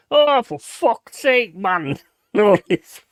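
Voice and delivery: exasperated mum. Retold or imitated. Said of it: "she's like".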